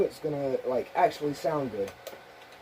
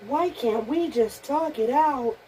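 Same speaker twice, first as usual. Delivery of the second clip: higher, slow, pleading toy; 7 semitones higher, slower than usual. Pretend. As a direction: drag out the plea like a scared toy. Repeat of Toy Story line.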